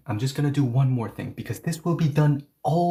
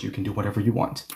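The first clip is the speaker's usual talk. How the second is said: usual speaking voice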